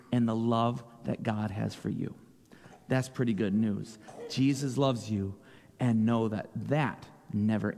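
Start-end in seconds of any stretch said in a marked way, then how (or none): none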